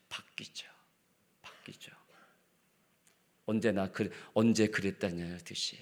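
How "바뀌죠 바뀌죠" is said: breathy whispered aside, almost no voice. Real, not acted. Preacher whispers to the congregation how we change. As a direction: let it drop to a hushed whisper.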